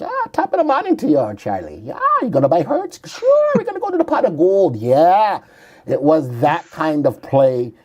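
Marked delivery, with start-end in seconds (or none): 0.0-4.7 high hammed-up Irish brogue; a cheesy leprechaun Irish accent; mock Irish accent. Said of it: "the luck of the Irish".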